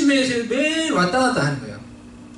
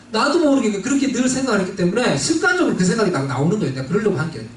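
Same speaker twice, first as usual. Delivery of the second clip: usual speaking voice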